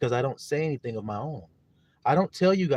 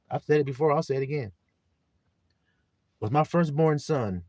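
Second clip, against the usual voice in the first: loud, higher-pitched sermon delivery; forceful preaching; 4 semitones higher, 8 dB louder than usual. Real, not acted. Preacher expounding scripture to his little flock, loud forceful preaching. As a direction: drive each phrase home to the congregation.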